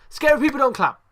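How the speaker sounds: loud, high-pitched outraged telling-off; loud, indignant rebuke; exasperated scolding. Acted. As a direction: yell it like scolding a hopeless wrestler. Mock scolding of a wrestler.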